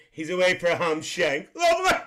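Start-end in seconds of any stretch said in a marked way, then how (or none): 1.6-2.0 high shrieked lovely; squealed lovely. Real, not acted. Reading a comment, shrieks her catchphrase lovely.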